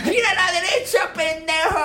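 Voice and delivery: high, laughing navigator voice; Sandler GPS voice. Pretend. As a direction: give directions like a rude Sandler GPS. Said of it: "Voy a aturdir".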